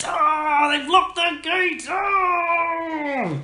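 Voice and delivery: high, wailing cry of defeat; thwarted Squirrel King. Acted. Villain finds the town gate locked, high slow wail. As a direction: howl in hammed-up frustration, drawn out. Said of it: "the Squirrel King goes".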